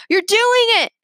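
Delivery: high, loud cheering yell; excited yell. A narrator's reading. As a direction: cheer him on from below. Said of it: "Remy yelled".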